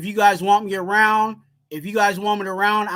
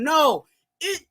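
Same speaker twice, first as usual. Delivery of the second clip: ordinary talk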